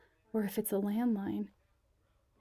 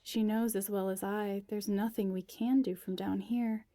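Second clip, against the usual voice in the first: ordinary delivery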